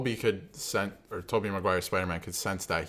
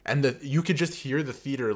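ordinary talk

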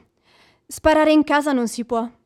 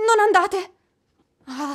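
ordinary delivery

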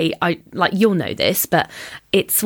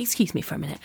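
hushed retold aside, much softer; small, polite voice; 9 dB quieter, faster than usual. Excusing herself from the annoyed stranger, quietly. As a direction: step away meekly, volume right down.

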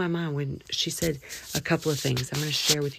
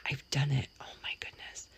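whisper; 7 dB quieter than usual. Real, not acted. Embarrassed aside about burping.

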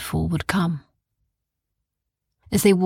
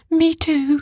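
quivering; 10 semitones higher than usual. A narrator's reading. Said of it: "quivered Oren".

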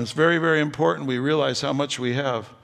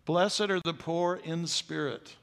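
slower, weighty second reading, "Blessed" drawn out; slow repeat; 2 semitones higher, 4 dB quieter, slower than usual. Shown as a demonstration. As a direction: let it sink in slowly. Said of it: "And he said".

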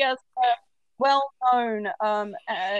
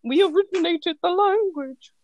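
raised, slow, laughing quote; quoting a drag queen; 7 semitones higher than usual. Retold or imitated. Quoting Ben de la Creme to mock Americans, laughing grand line. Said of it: "To quote Ben de la Creme".

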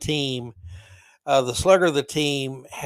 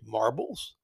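lower, softer confused echo; puzzled question; 6 semitones lower, 4 dB quieter than usual. Retold or imitated. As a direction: ask it baffled, half to yourself. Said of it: "And the guy goes".

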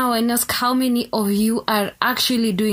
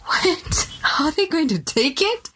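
higher, incredulous mock of the worry; mocking repeat; 5 semitones higher than usual. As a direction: throw the fear back in disbelief.

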